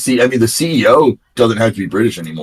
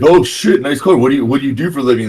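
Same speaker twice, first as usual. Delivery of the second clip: high, louder mock amazement, then rapid question; impressed; 6 semitones higher, 3 dB louder, faster than usual. Storyteller as YouTuber admiring kids' car, impressed. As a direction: imitate someone wowed, rushing into the question.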